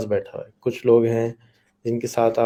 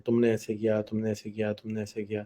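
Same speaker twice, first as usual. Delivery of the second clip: mock blaming; 2 semitones lower, 8 dB quieter than usual. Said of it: "کسی کو blame کرنا شروع کر دیتے ہیں".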